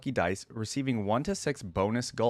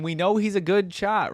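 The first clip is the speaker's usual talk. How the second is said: ordinary delivery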